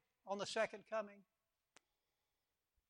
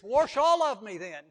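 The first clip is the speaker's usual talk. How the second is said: eager plea; 3 semitones higher, 15 dB louder than usual. Acted. Peter eagerly asking to be washed.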